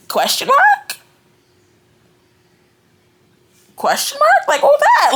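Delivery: baffled granny. Retold or imitated. Granny stunned by Abby's remark, high and baffled. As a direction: pitch way up, confused to the core.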